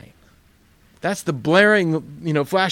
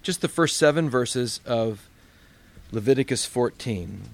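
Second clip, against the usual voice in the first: ordinary talk, nothing marked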